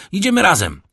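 loud, high corporal's call. A narrator's reading. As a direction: call out a decision to your partner. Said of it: "zawołał kapral".

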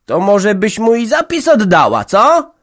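put-on high, loud biting old mother; sarcastic jab. A narrator's reading. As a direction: needle her with scorn. (matka kpi gniewnie)

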